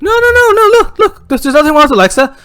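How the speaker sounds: high, loud, hurried denial; protesting dad, pitch way up; frantic reassurance. Acted. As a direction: talk the kid down in a fluster. Dad hastily denies the problem.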